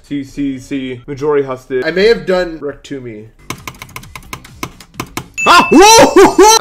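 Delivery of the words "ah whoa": high, loud whoop of surprise; startled yell. Real, not acted. Gamer startled mid-level, high startled shout. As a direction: yelp it in sudden shock.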